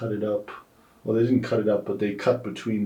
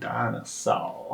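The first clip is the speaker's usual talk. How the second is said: put-on southern accent; 7 dB quieter, slower than usual. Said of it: "emphasizes it so much".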